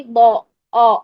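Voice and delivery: "بو، او" with a thick, rounded aw; heavy aw sound. Shown as a demonstration. Teacher answering what the sound is, "بو، او" heavy and rounded. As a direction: round "بو، او" into a heavy back vowel. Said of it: "او کی sound آئے گی".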